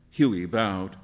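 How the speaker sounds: ordinary delivery, nothing marked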